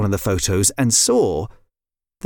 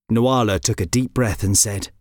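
plain reading voice

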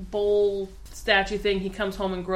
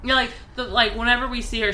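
ordinary talk

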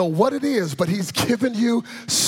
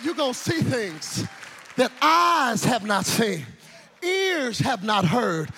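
normal talking voice

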